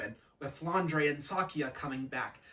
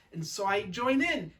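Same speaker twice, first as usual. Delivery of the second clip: plain reading voice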